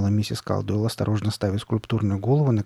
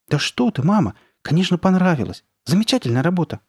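higher, eager, quick protest; hurried reassurance; 8 semitones higher, 4 dB louder than usual. A narrator's reading. Daughter reassures her upset mother, hurried and eager. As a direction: rush to assure her you love it. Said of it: "быстро проговорила Карли Бет".

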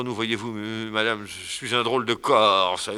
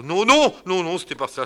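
sudden high, loud no, no; excited refusal; 9 semitones higher, 8 dB louder than usual. Acted. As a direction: burst in to refuse.

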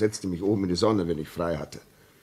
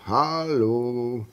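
long drawn-out, higher hailing call; ghostly calling voice; 4 semitones higher, much slower than usual. Retold or imitated. Signalman imitating the voice he heard, drawn-out eerie call. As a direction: stretch each word like an eerie call from afar. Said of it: "eine Stimme, die rief".

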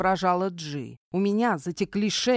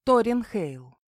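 plain reading voice

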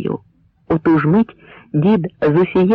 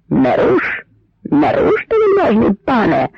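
high-pitched desperate protest; old man's alarmed shout, narrator's volume; frantic cry; 6 semitones higher than usual. A narrator's reading. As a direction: cry out to stop him, pitch leaping up. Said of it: "закричав дід не своїм голосом".

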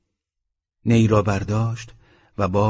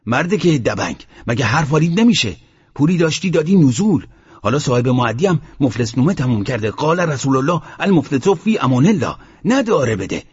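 acted scolding, higher-pitched and heated; retold angry shouting; 4 semitones higher, 3 dB louder than usual. The sheikh berates Mohammad over the loan, angry and raised. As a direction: imitate an irate sheikh's tirade. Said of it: "خشمش گرفت و باز داد زد".

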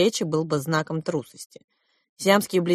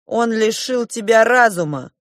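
louder, higher firm declaration; resolute, raised-voice verdict; 6 semitones higher, 5 dB louder than usual. A narrator's reading. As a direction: drive it home to your brother. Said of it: "твёрдо сказал младший принц".

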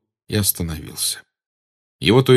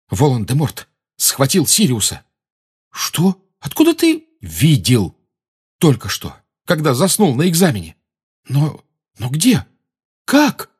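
alarmed exchange; 6 semitones higher than usual. A narrator's reading. Urgent exchange of friends.

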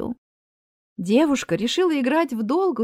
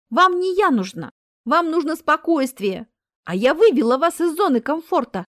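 higher, louder retort; запальчивое возражение; 6 semitones higher, 4 dB louder than usual. Acted. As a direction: argue back with raised pitch. Marina argues back with Death. (запальчиво, высоко и громко)

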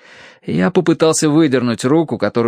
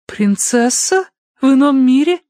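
astonished; 14 semitones higher, 3 dB louder than usual. A narrator's reading. Tossie hears her cat is dead, astonished. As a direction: ask it in high-pitched amazement. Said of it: "изумилась Тосси".

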